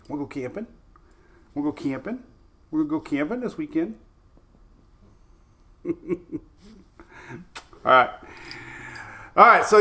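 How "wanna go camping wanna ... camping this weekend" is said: soft dog talk. Real, not acted. Talking to dog Louie.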